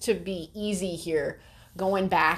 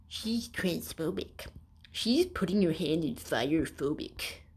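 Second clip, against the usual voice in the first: slow, softer mock whine; mocking the writer; 5 dB quieter, slower than usual. Mocking the article writer in a slow, softer whine. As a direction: drag it out like a whiny critic. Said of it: "you're here being like".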